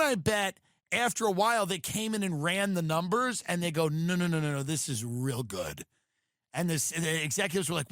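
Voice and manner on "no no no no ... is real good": mock executive. Executives defending gross ads.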